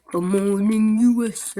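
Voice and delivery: loud, low mock British-American greeting; British U S A accent. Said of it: "British U S A accent".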